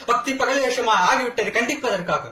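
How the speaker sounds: high, ringing condemnation; righteous denunciation. Acted. Accused condemns sham devotion before the court, righteous outrage. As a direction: let the voice soar in outrage.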